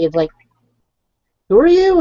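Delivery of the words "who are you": mock-incredulous challenge, higher and louder; lightly acted indignant disbelief; retold indignation. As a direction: retell an affronted comeback with a raised voice. Retold indignant reply to rude manager.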